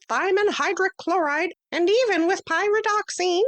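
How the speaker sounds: a tiny teacher calling the class to order; high little mouse-teacher voice; small Stuart voice. Acted. Stuart lectures on vitamins.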